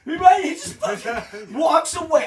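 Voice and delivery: high, loud laughing disbelief; real exasperated outburst; incredulous. Real, not acted. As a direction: drag out walks away in comic outrage. Incredulous at the plot.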